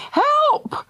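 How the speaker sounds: put-on high frantic child scream; screaming for help. A narrator's reading. Fergal screams as the lava follows. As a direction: shriek for help. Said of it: "screamed Fergal".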